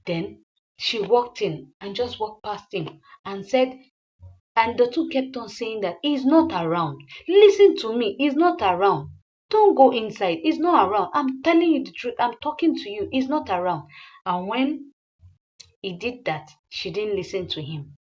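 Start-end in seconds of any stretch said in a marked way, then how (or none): 6.0-13.9 raised, urgent insisting; pleading insistence. Acted. Gatekeeper pleading loudly to block Jesse at the door. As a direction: insist loudly he's not around.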